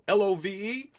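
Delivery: spelled out high then a whooping cry; excited whoop. Real, not acted. Host whooping over love to his viewers. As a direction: cheer the word love out loud.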